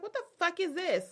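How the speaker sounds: high incredulous mock; mock outraged girlfriend. Girlfriend opening melted strawberry gift, mock outrage. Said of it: "She was like".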